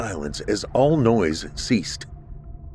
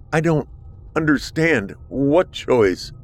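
higher, slow confused asking; bewildered; 6 semitones higher, slower than usual. A narrator's reading. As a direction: grope for the meaning. Arthur confused, voiced higher.

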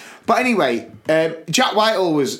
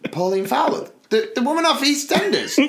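baffled question; 11 semitones higher than usual. Retold or imitated. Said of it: "And I went".

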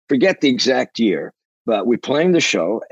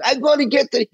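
high-pitched mock shout. Drummer held back from the stage, high mock shouting. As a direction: imitate a frantic rocker's high yell. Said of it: "screaming and shouting".

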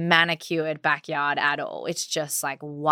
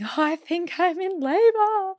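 pitched way up with delighted disbelief; high, excited realization; 12 semitones higher than usual. Retold or imitated. Mother on video announcing her labor to Bodhi, high and excited. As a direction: sing it out like thrilling news.

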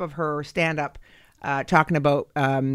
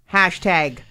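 usual speaking voice

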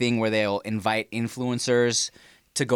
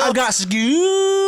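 very slow, higher, louder drawn-out boast; mock bragging nerd; 12 semitones higher, 5 dB louder, much slower than usual. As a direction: stretch out the boast like a smug show-off.

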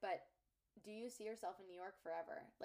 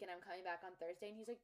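ordinary talk

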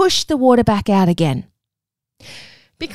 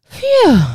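blowing out breath; 14 semitones higher, 5 dB louder than usual. Shown as a demonstration. Breath blown out in demo.

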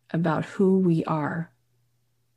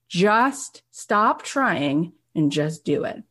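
each word slowly and deliberately laid down; slow right down to drive it home; slow emphasis; much slower than usual. Real, not acted. Urging listeners to simply act, slow and deliberate.

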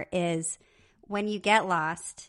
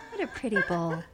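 laughing; 3 dB quieter than usual. Pretend. Laughing fish imitation.

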